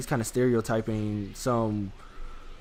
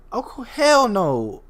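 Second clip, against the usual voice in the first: stretched disbelief; 8 semitones higher, 4 dB louder, much slower than usual. Retold or imitated. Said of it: "I'm thinking in my head".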